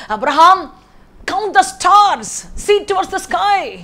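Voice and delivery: high, slow, booming call of God to Abraham. Acted. God calls Abraham to count the stars, slow and booming. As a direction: stretch each word out like a voice from heaven.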